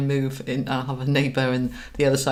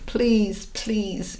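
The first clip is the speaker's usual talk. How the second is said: begging; 5 semitones higher than usual. Shown as a demonstration. Coach mimics someone begging on their knees, pleading. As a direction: plead as if disempowered. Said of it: "which is disempowered and begging".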